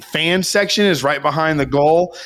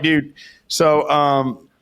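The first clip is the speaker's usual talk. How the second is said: usual speaking voice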